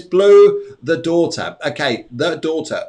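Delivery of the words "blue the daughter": reading out. Retold or imitated. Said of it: "Tonya says".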